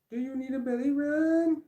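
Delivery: very high, squeaky pet-talk "Do you need a belly rub?". Real, not acted. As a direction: ask the dog in a high cooing voice. Talking to dog about belly rub.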